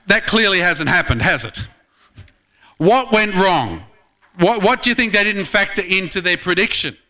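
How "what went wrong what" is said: ordinary talk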